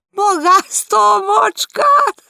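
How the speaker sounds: very high, loud, slow exclamation; amused exclamation. Acted. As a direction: cry it out in scandalised delight. Hostess laughing outburst.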